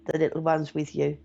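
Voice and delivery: ordinary delivery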